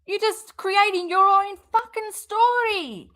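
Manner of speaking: high, loud exasperated outburst; disgusted rant at the screen; outraged. Real, not acted. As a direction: let the outrage burst out. Host outraged at the streamer.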